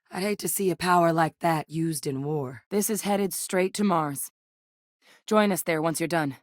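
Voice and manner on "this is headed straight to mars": ordinary delivery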